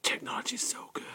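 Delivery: breathy mock-creepy whisper; co-host mocking a keyboard fetishist, whispered.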